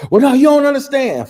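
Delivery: loud, high defiant outburst; a teen son shouting his father down; son yelling back. Retold or imitated. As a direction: shout back at your dad. Son talking back loudly.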